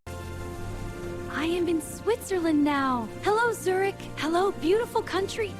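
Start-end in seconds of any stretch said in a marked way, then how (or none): 0.1-1.4 slow, drawn-out savoring; very slow talking. Real, not acted. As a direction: stretch each word out in wonder. Savouring the moment.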